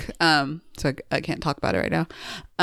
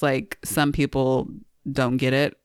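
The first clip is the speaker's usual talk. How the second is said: ordinary talk, nothing marked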